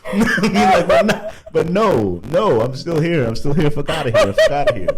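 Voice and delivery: high, loud laughing boast; laughing defiance. Real, not acted. Rapper laughing at haters that he is still here, high and loud. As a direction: laugh it off and gloat.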